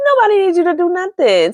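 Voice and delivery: sassy retort. Retold or imitated. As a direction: fling it back, sassy.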